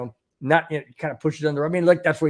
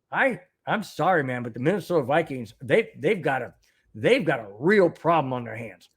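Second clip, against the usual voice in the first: normal talking voice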